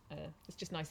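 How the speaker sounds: ordinary talk, nothing marked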